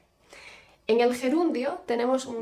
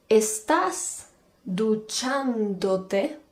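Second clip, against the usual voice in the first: very slow, drawn-out teacher example; slow classroom demo voice; slow model question; 4 dB louder, much slower than usual. Shown as a demonstration. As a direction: spell it out slowly for learners.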